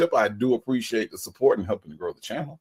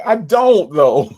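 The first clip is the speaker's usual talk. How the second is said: laughing denial; 11 semitones higher, 6 dB louder, slower than usual. Real, not acted. Viewer says he looks like [name].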